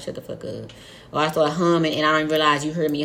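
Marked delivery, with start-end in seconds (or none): 0.0-0.7 low, soft muttered curse; muttered under breath. Retold or imitated. Muttering at her dude to shut up. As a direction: grumble it to yourself. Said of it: "and I'm like".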